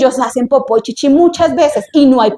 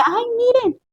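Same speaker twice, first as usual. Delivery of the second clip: high, softer cry of discovery; princess spotting something; 10 semitones higher, 5 dB quieter than usual. Acted. Princess to her maids on seeing the basket, surprised discovery. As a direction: call the others' attention, pitched up. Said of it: "cuando ella dice".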